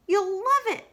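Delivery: eager little promise; Piglet voice. Acted.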